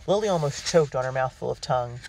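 plain reading voice

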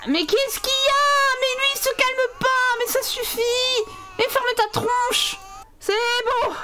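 high, exasperated scolding at the screen; exasperated yelling. Real, not acted. Gamer scolding a screaming Sims baby, exasperated high yell. Baby won't stop crying.

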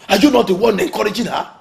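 loud, high, angry challenge; heated marital yelling; shouting accusation. Acted. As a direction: throw the blame at her, shouting. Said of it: "Don't shout at me like that".

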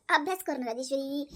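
ordinary delivery

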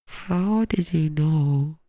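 very slow, stretched-out question; melodramatic disbelief. Acted. Streamer confronting chat about the leaked secret, drawn-out disbelief. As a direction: draw every word right out.

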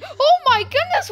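high surprised gasp. Real, not acted. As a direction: squeal it out, startled. Repeat, much higher.

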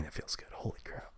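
hushed, breathy "holy crap"; awed whisper to himself after finishing the episode; whispered awe. Real, not acted. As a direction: breathe it out in quiet disbelief.

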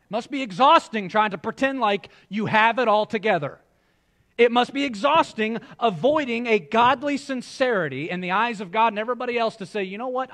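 fervent preaching. Acted. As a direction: preach it out with rising fire. Preaching at full force.

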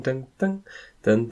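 normal talking voice

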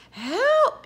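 high, loud scream for help; frantic character yell; panicked cry. A narrator's reading. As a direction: shriek it as if drowning. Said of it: "he cried".

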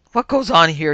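loud, startled yell; shouted demand; indignant yell. A narrator's reading. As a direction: yell it in outrage. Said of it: "yelled the mighty Omar".

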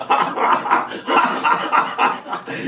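high, scornful cackling laugh; mocking laughter. A narrator's reading. Uncles jeering at the silly explanation with mocking laughter. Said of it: "Hohngelächter".

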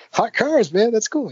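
high, thrilled gush over the game's name; excited. Retold or imitated. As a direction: gush like a kid who loves the title. Retelling his own thrilled reaction.

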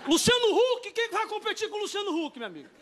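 hammed-up incredulous yell; exclaimed disbelief. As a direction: cry out at the hopeless contest. Exclaiming at a famous rival.

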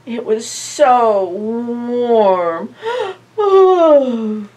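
usual speaking voice

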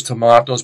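"tomatoes" with a long AH, tuh-MAH-toes; British pronunciation. Shown as a demonstration. Teacher contrasting with Americans, British "tomatoes" as tuh-MAH-toes. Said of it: "we say tomatoes".

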